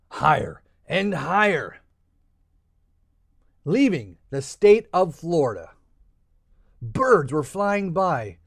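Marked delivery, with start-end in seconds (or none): none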